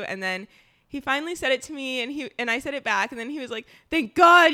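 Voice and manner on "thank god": much louder, higher burst of relief; mock relief. Boyfriend relieved after she says it.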